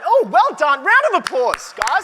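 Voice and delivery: loud, high, cheering praise; excited. Real, not acted. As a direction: whoop up the crowd's result. Host cheers room on results.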